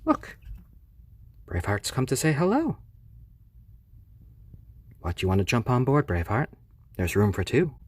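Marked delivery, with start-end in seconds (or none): 1.5-7.7 higher, louder and fast; 1 excited welcome. Real, not acted. Owner greeting a second chick, excited and quick. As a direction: welcome the new arrival eagerly.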